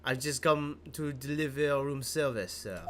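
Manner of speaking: slow, courteous French bellboy; unhurried French accent. Acted. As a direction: offer the room service in a French accent. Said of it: "I get to do a French accent".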